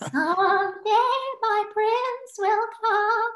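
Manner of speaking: high, slow sung princess voice. Pretend. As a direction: sing it like a Disney princess.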